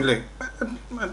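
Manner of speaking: normal talking voice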